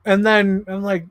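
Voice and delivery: ordinary talk, nothing marked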